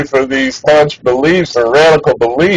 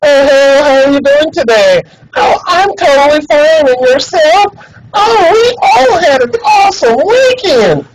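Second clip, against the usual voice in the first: high, bright put-on office voice, every line climbing; mock chipper coworker voice; 9 semitones higher than usual. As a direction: ham up an overly cheerful coworker small-talk voice. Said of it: "start talking like this".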